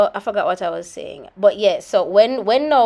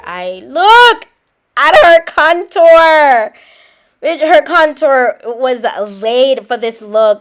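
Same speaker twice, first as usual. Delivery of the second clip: slow, loud, drawn-out rave; dramatic gush; 4 semitones higher, 11 dB louder, much slower than usual. Real, not acted. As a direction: savour every word of the praise. Gushing over the contour.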